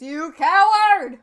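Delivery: loud, slow, drawn-out insult; slow angry shout. Acted. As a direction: shout it slowly at him. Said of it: "He goes".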